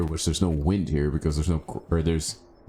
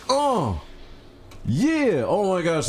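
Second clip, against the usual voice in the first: high-pitched whoop of triumph; triumphant thrill; 12 semitones higher, much slower than usual. Real, not acted. Player just landed a rock jump, thrilled. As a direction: exclaim as the leap pays off.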